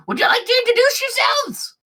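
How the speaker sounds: very high put-on squeak; eager character voice. Bug turning to the party for introductions, high squeaky voice.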